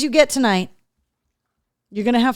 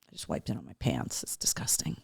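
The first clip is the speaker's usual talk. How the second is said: whispered aside; hushed disgusted aside; 16 dB quieter than usual. Real, not acted. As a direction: whisper it, half to yourself. Aside about dog's eye.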